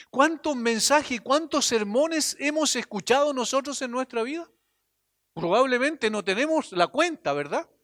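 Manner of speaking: ordinary delivery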